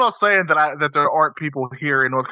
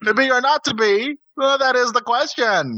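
put-on high, hammy Southern-accented Shakespeare; bad Southern acting; 10 semitones higher, slower than usual. Would-be actor does Hamlet, fake Southern accent. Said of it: "that's how I would always act".

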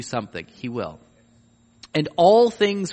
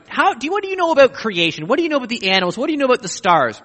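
higher, louder, fast rhetorical grilling; God grilling Job about creation, raised and rapid; challenging God voice; 9 semitones higher, 5 dB louder, much faster than usual. Acted. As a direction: fire the questions at Job one after another. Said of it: "he starts talking about".

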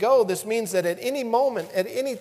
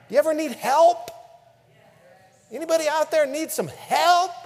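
higher, louder call to the crowd; 7 semitones higher, 5 dB louder than usual. Real, not acted. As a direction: call out to the whole room. Preacher calls out to congregation.

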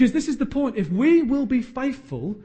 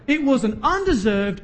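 normal talking voice

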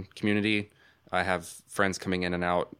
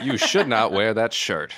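mock celebrity line; 3 semitones higher, 6 dB louder, slower than usual. Mocking the Freeman video.

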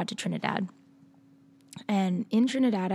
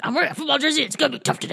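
tough jock voice; 7 semitones higher, 5 dB louder, much faster than usual. Retold or imitated. Quoting her sporty persona.

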